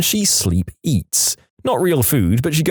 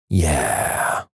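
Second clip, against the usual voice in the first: low, softer drawn-out finish; drawn-out yeah; 8 semitones lower, 3 dB quieter than usual. A narrator's reading. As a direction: let it trail out low and long. Finish of a bizarre sleep song.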